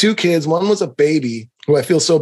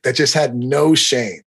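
ordinary talk

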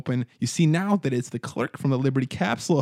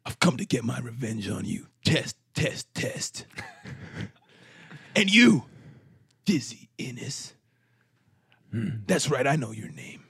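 menacing avenger, lightly voiced; understated threat with a jump on the accusation; angry; 4 dB quieter than usual. Grant vowing revenge on the players, restrained angry menace. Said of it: "looks at you angrily".

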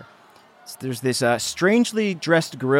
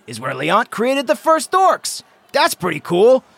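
cheery mom toast; 6 semitones higher, 3 dB louder than usual. Acted. GM plays Meriden's toast.

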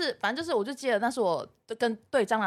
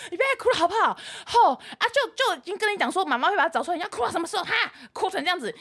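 exasperated mom; 7 semitones higher than usual. Retold or imitated. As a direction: nag the kid with worn-out patience. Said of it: "因为我妈快被我气死了".

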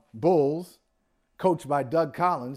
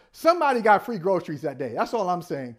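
normal talking voice

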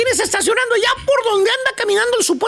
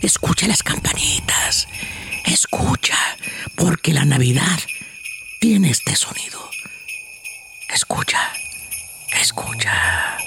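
soft unvoiced whisper; right in your ear; 4 dB quieter, slower than usual. Meditation, whispering about the bells.

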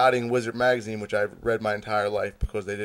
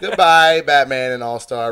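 loud, dismissive mock send-off; mock farewell; 9 dB louder than usual. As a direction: burst out with a sarcastic goodbye.

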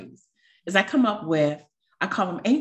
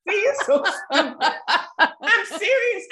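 much higher, laughing through the words; laughing at herself; 13 semitones higher than usual. Real, not acted. Embarrassed about crying on the podcast, laughing. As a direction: laugh it off while insisting it's real.

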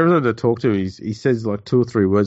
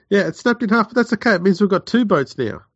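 much higher and louder, chirpy denial; deluded captain; 10 semitones higher, 3 dB louder than usual. Retold or imitated. Co-host playing the sinking captain, chirpy mock optimism. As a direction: spin the disaster as good news.

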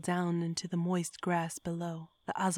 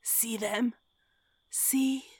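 hoarse whisper; 5 semitones higher, slower than usual. A narrator's reading. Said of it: "With a hoarse whisper, I commanded".

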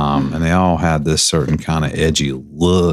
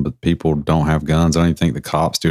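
usual speaking voice

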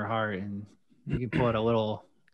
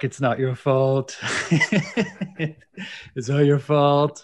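slow, soothing therapist voice with laughter; mock tender comfort; consoling voice; slower than usual. Robin Williams consoling the kid.

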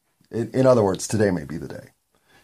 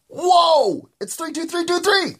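squeaky shout-out; slower than usual. Acted. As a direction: squeal the date like a cartoon character. Excited shout-out of the date.

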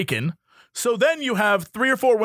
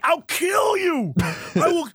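higher, slow shouted threat; mock threat; 6 semitones higher, slower than usual. Hothead yelling threats before security comes. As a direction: bellow it like a hothead losing it.

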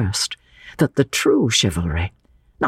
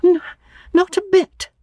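trembling lips. A narrator's reading. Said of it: "with trembling lips".

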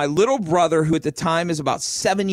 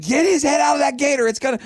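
panicked mom shout; 11 semitones higher, faster than usual. Retold or imitated. Mother yelling at the boy by the gator, panicked shout. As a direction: shriek at him to stop.

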